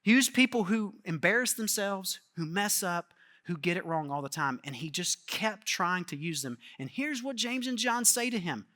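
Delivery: ordinary delivery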